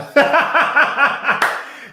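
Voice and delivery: high, loud cackling burst of laughter; big laugh. Real, not acted. Cracking up at the friend's planned mimicry, laughing.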